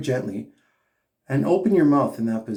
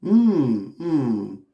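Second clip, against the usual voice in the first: repeated hum. Shown as a demonstration. Coach asks singers to bring a hum in there, humming. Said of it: "bring a little bit of a hum in there".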